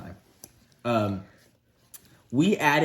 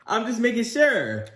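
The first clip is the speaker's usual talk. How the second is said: giggling, pitched up; laughing; 11 semitones higher than usual. Real, not acted. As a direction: laugh through the line. Son laughs back at mom.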